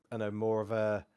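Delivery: ordinary talk, nothing marked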